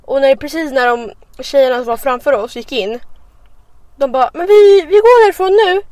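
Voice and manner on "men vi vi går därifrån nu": excited quote. Retold or imitated. Quoting girls leaving.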